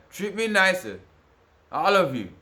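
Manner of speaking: high, loud demand to be treated better; loud plea. Real, not acted. To everyone who mistreats him, a high loud plea.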